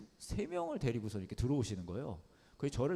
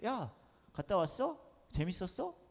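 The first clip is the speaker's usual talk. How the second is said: lightly higher, slow offhand dad; casual dad question; 6 semitones higher, slower than usual. Retold or imitated. Father casually asking his daughter about camp, lightly voiced. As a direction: ask it like a dad who barely cares. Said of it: "캐주얼하게 그냥 물어본".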